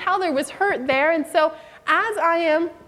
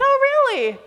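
high, bright exaggerated oh really; mock delighted interest in her wind chimes; eager surprise; 11 semitones higher, faster than usual. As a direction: hook her into talking more.

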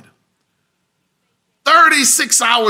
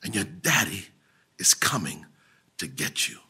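much lower, much softer whisper; hushed whisper; 12 semitones lower, 10 dB quieter than usual. Acted. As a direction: breathe the promise to them. Intimate close to the sermon.

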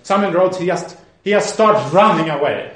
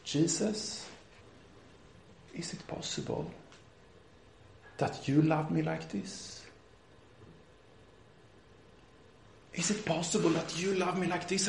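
hushed, halting plea with long pauses; whisper-soft retold prayer of disbelief; tearful prayer; 14 dB quieter than usual. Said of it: "And he's weeping".

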